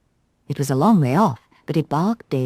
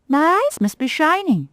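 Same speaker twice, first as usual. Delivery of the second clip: higher, prim rag doll voice; a proud little doll, miffed; Galdora the rag doll; 11 semitones higher, 3 dB louder than usual. Acted. Rag doll sniffing at the conceited cat. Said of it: "thought Galdora".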